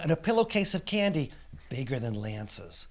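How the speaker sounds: ordinary delivery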